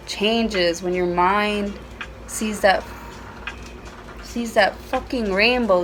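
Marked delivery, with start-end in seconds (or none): none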